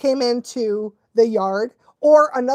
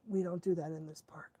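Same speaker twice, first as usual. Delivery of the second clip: very soft, low, near-whispered; calm dog leader; 5 semitones lower, 19 dB quieter, faster than usual. Acted. Calm dog correcting another dog, hushed and low. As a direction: quietly set the rule, almost whispering. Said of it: "He just was like".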